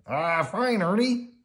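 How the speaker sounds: high, loud reply, rising at the end; Bert. A narrator's reading. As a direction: answer a pal in a puppet voice. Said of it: "he said".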